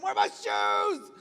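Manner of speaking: slow, strained full-volume yell; mad shout. Acted. Late spouse mad at partner over lost shoes, shouting angrily. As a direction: bellow it in hammed-up spousal anger. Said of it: "You're so mad at 'em".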